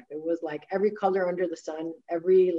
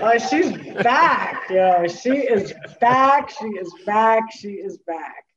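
gleeful she's back, slowing and trailing off; laughing delight; 4 semitones higher, 7 dB louder, slower than usual. Retold or imitated. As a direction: savour it, laughing, then let it die down. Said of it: "I was like".

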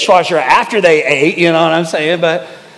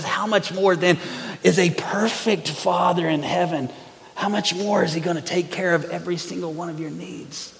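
soft pastoral appeal; 3 semitones lower, 8 dB quieter than usual. Real, not acted. Gentle closing appeal.

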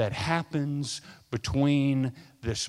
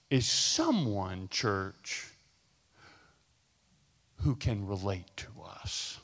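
soft, low pulpit hush; quiet, much softer sermon voice; hushed preaching; 6 semitones lower, 6 dB quieter than usual. Acted. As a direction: draw the church in close and tender. Preacher drops to intimate hush.

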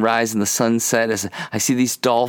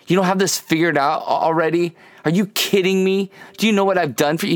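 higher-pitched mock telling-off; exasperated scolding; 6 semitones higher, faster than usual. Preacher voicing the scolding God never gives us, mock exasperated. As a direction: imitate someone losing patience, pitch raised. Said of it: "He's not shaking us and go".

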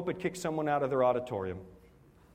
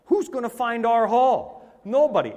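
mock alarm; 6 semitones higher, 7 dB louder than usual. Recalling the lost taxi drivers, mock alarm building loud. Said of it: "And I thought".

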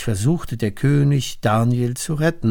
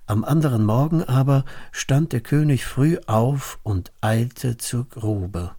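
plain reading voice

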